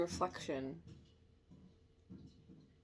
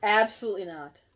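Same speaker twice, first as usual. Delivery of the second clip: firm loud no; 9 dB louder than usual. Real, not acted.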